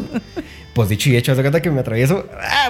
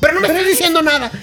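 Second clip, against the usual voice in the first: much higher, mock exasperated mother; imitating an exasperated mom; 12 semitones higher than usual. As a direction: mom losing patience with her kid. (mamá exasperada, voz aguda)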